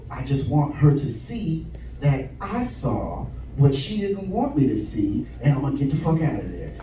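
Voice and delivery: dropped low and soft, plotting; low scheming voice. Acted. Comic hearing the shower, plotting quietly to himself, low and soft. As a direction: murmur the plan to yourself.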